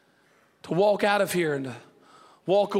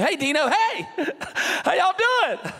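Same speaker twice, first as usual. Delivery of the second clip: cheery barista greeting; 10 semitones higher than usual. Retold or imitated. Baristas greet a regular by name.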